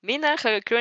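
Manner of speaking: ordinary delivery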